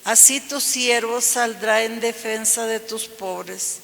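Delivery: ordinary talk, nothing marked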